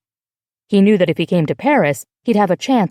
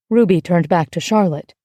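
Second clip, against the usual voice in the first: plain reading voice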